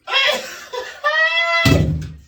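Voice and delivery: loud squealing laugh; high shrieking laugh. Real, not acted. After squeezing water in.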